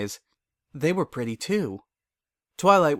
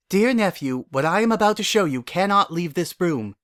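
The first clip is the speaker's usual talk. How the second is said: a hint of a stern royal aunt, higher; stern princess; 7 semitones higher, 3 dB louder than usual. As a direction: warn the nephew gravely. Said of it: "she said sternly".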